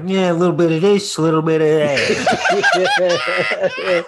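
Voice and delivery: high, loud, drawn-out dodge; comic evasiveness. Acted. Dodging a cab driver asking about work, comically evasive. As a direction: stretch the last words into a silly squeal.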